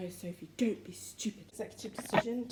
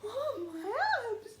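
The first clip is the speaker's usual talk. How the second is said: pained cry; 12 semitones higher, much slower than usual. Retold or imitated. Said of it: "I was like".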